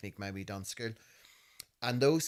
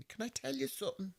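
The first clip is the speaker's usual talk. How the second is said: light imitation of mum, pitched higher; 14 semitones higher, 4 dB quieter than usual. Mum quietly starts her confession to her son, lightly imitated. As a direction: gently voice mum's opener. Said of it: "She says".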